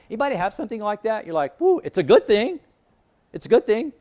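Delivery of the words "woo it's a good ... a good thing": high, loud whoop of delight; mock excitement. Preacher voicing a listener tempted by a good thing, mock excited. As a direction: play up a giddy, tempted reaction.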